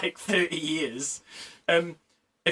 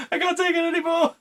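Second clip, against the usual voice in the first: much higher, faster whiny "can't take it anymore"; mock wail; 13 semitones higher, faster than usual. Traumatised crew mate cracking, high fast whine. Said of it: "repeated take".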